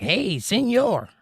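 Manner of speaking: Spanish word in a slow, high English accent; Spanglish accent. Shown as a demonstration. Streamer demoing Spanglish, an English accent on Spanish words. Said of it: "Spanish words, but with an English accent".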